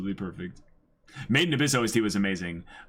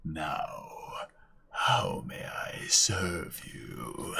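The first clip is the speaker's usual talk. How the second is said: slow, breathy seductive whisper; sultry whisper; 4 semitones lower, 5 dB quieter, much slower than usual. Retold or imitated. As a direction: whisper it slowly, like a tempting sorcerer. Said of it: "sexy voice".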